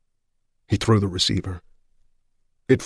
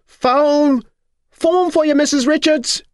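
high, loud hallway yell; 6 dB louder, slower than usual. Acted. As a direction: call out down the hall for her. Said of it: "knocking and yelling".

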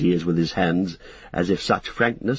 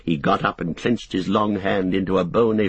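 usual speaking voice

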